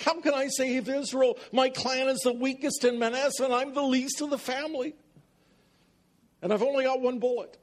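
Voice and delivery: high, nervous Barney Fife voice; high fretful Gideon in a Don Knotts voice; Don Knotts impression. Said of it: "in my best Don Knotts voice".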